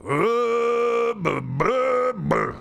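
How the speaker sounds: high, loud caveman grunting; caveman grunts. Pretend. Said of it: "my grunts are not good enough".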